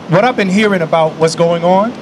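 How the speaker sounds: ordinary talk, nothing marked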